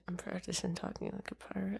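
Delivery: very soft, thrown-away quote; retold undertone. A person explaining their pirate practice, very soft. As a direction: say it under your breath.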